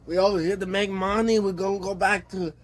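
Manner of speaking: mock Mexican tough guy calling Holmes, in a clumsy Mexican accent; bad Mexican accent. Imitating immigrants boasting of ruling Chihuahua, exaggerated Mexican accent. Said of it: "I can't do a Mexican accent".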